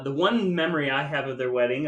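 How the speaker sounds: usual speaking voice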